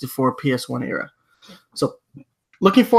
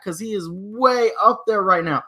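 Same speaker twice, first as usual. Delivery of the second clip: ordinary talk, nothing marked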